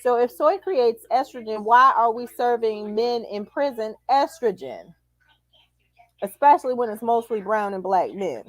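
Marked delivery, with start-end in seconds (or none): none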